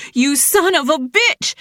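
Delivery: high, loud furious cry; angry repeat. A narrator's reading. Jilly striking Dylan a second time, furious shout. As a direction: hit him with the words again. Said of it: "Jilly repeated herself".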